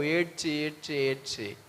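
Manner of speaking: slow, sinking repetition; gedehnt. Retold or imitated. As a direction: draw each word out, letting it fall away. Weeping all night, acted out.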